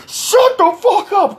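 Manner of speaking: high, drawn-out mock yell; imitating an enraged man's screech; angry retort. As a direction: stretch it out, furious and high. Insulted man snaps back in quarrel.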